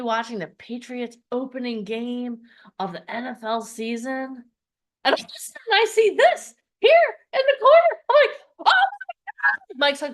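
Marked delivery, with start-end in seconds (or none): 5.0-9.8 high, loud thrilled disbelief. Real, not acted. Spotting her on TV.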